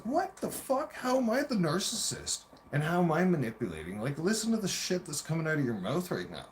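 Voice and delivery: high-pitched indignant retort; exasperated disbelief, voice way up; incredulous outrage. Retold or imitated. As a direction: fling the charge back, retelling the fight. Acted retort to being called narcissist.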